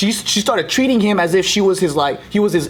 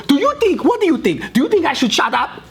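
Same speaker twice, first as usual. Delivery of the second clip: high-pitched, confrontational imitation; furious demand; 10 semitones higher than usual. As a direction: grill a bystander, hammed-up and indignant. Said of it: "She was furious at him".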